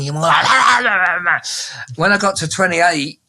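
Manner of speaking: ordinary talk, nothing marked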